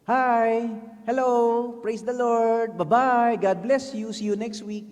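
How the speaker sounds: loud, cheery sing-song small talk; hammed-up fake church cheer; shallow churchy greeting. Small group members chirping empty greetings every week. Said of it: "Puro praise the Lord eh".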